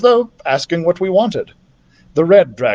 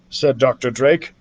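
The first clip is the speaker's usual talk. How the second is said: ordinary delivery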